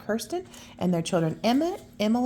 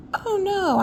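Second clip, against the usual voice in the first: much higher, louder no; high reassuring voice; 12 semitones higher, 6 dB louder than usual. Acted. Reassuring that Lars won't bring Oscar. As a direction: squeal it out brightly.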